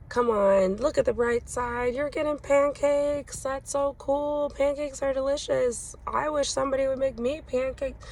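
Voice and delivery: high, bright, chirpy cheering-up voice; brightly insisting on the bright side; forced cheerful pep talk. Retold or imitated. Mom cheering up her sobbing son, high forced cheer. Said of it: "there is this instinct to be like".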